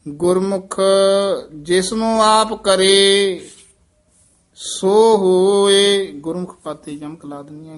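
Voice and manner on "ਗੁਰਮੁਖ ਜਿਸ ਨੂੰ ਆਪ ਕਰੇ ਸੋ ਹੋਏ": measured, chanted scripture reading; scripture recitation with long pauses; slow Gurbani recitation. Shown as a demonstration. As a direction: recite each phrase slowly and devoutly. Teacher chants Gurbani path.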